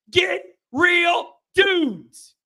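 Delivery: GET, REAL, DUDES very slow and high; emphatic demand. Real, not acted.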